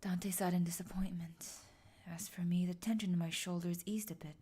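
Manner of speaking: low, hushed whispered narration. Acted. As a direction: whisper it like in church.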